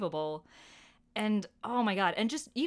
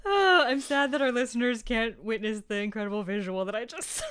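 laughing; 3 semitones higher, 6 dB louder than usual. Real, not acted. Reacting while laughing.

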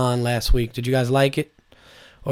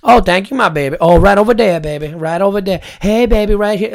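put-on higher and louder, calling everyone baby; sweet waitress voice; 6 semitones higher, 5 dB louder than usual. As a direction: play a Louisiana waitress sweet-talking a customer. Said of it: "they always call you baby".

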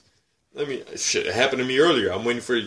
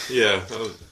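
ordinary talk

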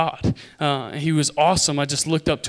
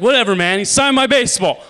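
put-on loud, high kid boast; thrilled, insistent shout; excited brush-off; 6 semitones higher, 8 dB louder than usual. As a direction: wave it off and crow loudly.

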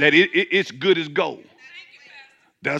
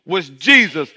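ordinary delivery

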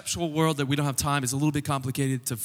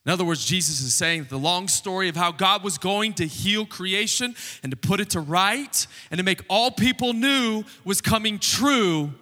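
emphatic preaching; 4 semitones higher than usual. Real, not acted. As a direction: proclaim it to the congregation, leaning hard on key words. Preaches the arrival of God's kingdom.

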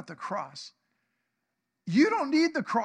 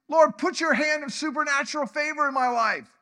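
higher and louder pleading prayer; fervent plea; 7 semitones higher, 6 dB louder than usual. Acted. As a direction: beg heaven with force. Jabez voiced praying to God.

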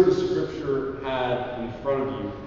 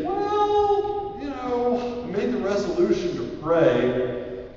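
starts high and sung then settles; sing-song reply; 9 semitones higher, slower than usual. Acted. You answering your flesh, playful sing-song reply. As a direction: sweetly point to the resolution. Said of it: "And then you say".

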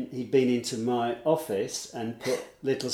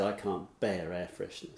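imitated Scottish lilt, lower and softer; lilting Scottish accent; 5 semitones lower, 9 dB quieter than usual. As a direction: quote him in a quiet Scottish lilt. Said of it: "You know, lilting Scottish accent".

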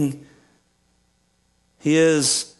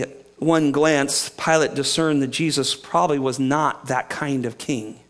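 usual speaking voice

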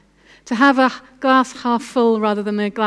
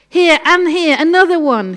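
wailing; 5 semitones higher, 4 dB louder than usual. A narrator's reading. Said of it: "Corrie wailed".

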